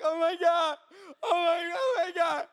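high, imitated wailing amazement; freaking out. Classmates witness a healing, imitated high freak-out. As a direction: repeat it high, as if overwhelmed. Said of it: "And people are freaking out".

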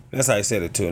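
normal talking voice

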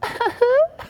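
high squealing mock giggle back at the baby; imitating a baby's high giggle; mock laugh. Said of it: "I went".

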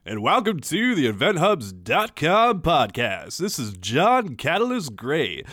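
hammy radio host intro; cheesy radio voice. Acted. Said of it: "my cheesy radio guy voice".

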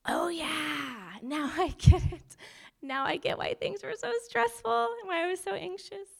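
high, bright "aha" voice; light up as it finally clicks; sudden realisation. Retold or imitated. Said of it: "and you're like".

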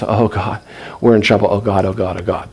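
low, hushed mock plea; frightened prayer. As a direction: mutter it over and over like someone in trouble. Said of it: "they became very frightened".

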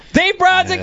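high, loud name announcement; announcer intro. Acted. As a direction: introduce the guest like a ring announcer.

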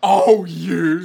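very loud hammy reaction; booming theatrical cry; mock exclamation. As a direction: belt it out at the big reveal. Mock reaction to villain's reveal.

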